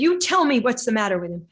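loud, insistent "You tell me"; insistent demand. Retold or imitated. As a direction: throw it back forcefully, pressing "tell". Healer insists, retold, louder.

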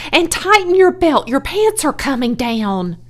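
high, loud scolding that eases off; crying out. A narrator's reading. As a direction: scold a child from afar. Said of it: "cried Missus Zuckerman".

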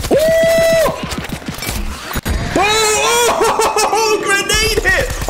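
high, whooping, laughing cheer; elated. Real, not acted. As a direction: whoop and laugh at a lucky hit. Celebrates a grenade hit.

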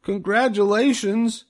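very high, eager pig voice calling out; Wilbur shouting. Acted. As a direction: burst out with it like an excited piglet. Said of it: "shouted Wilbur".

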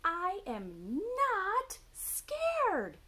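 high, slow, loud owlet shout; indignant little owl yelling; shouting Baby Owl. A narrator's reading. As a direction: stretch and shout every word, high. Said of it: "shouted Baby Owl".

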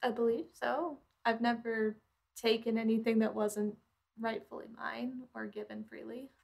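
ordinary talk, nothing marked

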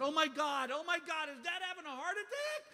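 rising high-pitched panic; hammed-up alarm at bad news; alarmed son. Stoned son hears dad is ill.

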